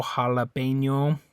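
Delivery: "jalapeño" slow, H for the J, pure O; Spanish jalapeño. Shown as a demonstration. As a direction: say "jalapeño" slowly, ha-la-PEN-yo. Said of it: "it's an O".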